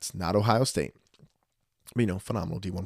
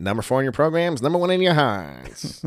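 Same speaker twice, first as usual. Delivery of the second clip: hammed-up announcer; 6 semitones higher, 6 dB louder than usual. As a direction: sell it like a cheesy ad. Teasing slogan, hammed up.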